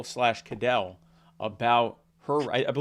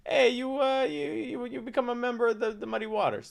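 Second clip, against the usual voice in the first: high-pitched mock governor voice, stammering along; Ciccarelli; 10 semitones higher than usual. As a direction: impersonate a New Jersey politician, pitched way up. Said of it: "That's how he talks".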